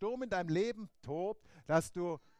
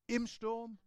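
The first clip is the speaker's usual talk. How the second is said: ordinary talk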